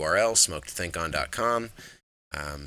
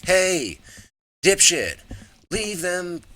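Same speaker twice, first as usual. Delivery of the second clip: high, loud yell across the room; calling out; 11 semitones higher, 6 dB louder, much slower than usual. Pretend. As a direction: shout it at him, high and sharp. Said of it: "I just wanna be like".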